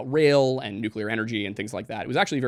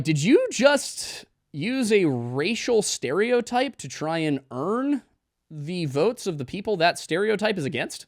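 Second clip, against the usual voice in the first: incredulous; 7 semitones higher, slower than usual. Retold or imitated. Incredulous mock question to Clinton.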